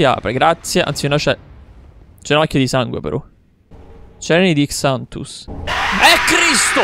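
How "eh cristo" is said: high sudden yelp of fright; jumped-out-of-skin cry; startled shout. Real, not acted. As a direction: shout at the scare. Jump scare while playing.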